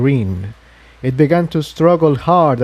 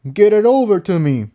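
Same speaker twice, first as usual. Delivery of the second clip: strained, higher character voice, words pushed through clenched teeth; through gritted teeth; 9 semitones higher than usual. A narrator's reading. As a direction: Jigsaw straining under effort, teeth clenched. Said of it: "said through gritted teeth".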